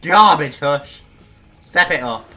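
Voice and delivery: loud, punchy trash talk; shouted jeer, first word hardest; mock taunting shout. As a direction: heckle the opponent at full volume. Taunting the game boss mid-fight.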